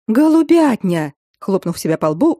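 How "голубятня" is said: slow, high, loud exclamation; exclaiming. Acted. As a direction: cry out the realization. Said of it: "воскликнула Катя".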